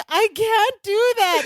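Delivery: high, drawn-out mock panic; slow, high-pitched anxious protest, playfully retold. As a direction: imitate someone starting to panic. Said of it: "He's starting to panic".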